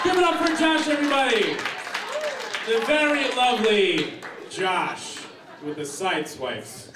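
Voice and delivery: high, loud hyped-up showman; kids party magician. Acted. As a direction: yell it like a stage magician. Emcees a magic act on stage.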